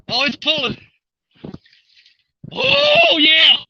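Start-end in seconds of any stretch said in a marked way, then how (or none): 2.5-3.6 high, loud whooping "oh yeah"; elated whoop. Real, not acted. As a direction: shoot up high in real triumph, then land it loud. Fish pulls, angler whoops.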